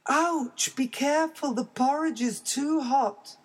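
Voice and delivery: high cub yelping at hot food; startled little bear cry; baby bear ouch. Acted.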